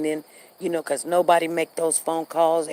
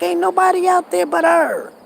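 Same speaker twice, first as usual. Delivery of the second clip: much higher and louder, almost yelling; exasperated shout; 12 semitones higher, 6 dB louder than usual. Retold or imitated. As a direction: throw it out in disbelief, full voice. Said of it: "I'm like".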